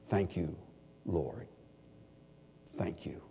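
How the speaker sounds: soft, sincere, near-whispered gratitude; whispered thanks. Real, not acted. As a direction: breathe a quiet heartfelt thanks. Heartfelt prayer of thanks.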